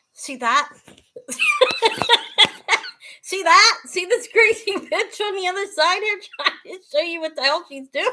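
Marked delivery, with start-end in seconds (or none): 1.1-3.2 high squealing burst of laughter; loud, high cackle; helpless laughing fit. Real, not acted. Cracks up at her own fumbling. 3.2-8.1 raised voice breaking into laughter; high, amused and losing composure; laughing self-mockery. Real, not acted. Laughing at her own fumbling.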